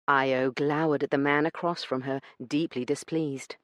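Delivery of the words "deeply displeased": ordinary talk, nothing marked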